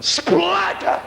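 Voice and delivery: very high sudden cry; high exclamation. Acted. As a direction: burst it out high. Punchline impact sound.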